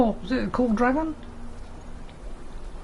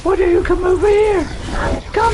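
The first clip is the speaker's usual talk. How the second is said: shout; 9 semitones higher, 4 dB louder than usual. Acted. Yelling at dragon.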